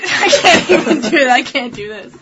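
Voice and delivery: breaking up with laughter; laughing. Real, not acted. Co-host failing at her accent turn, laughing. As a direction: give up through real laughter.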